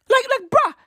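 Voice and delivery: high exasperated bruh. Real, not acted. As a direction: throw up your hands, voice jumps up. Exasperated outburst at Forbes.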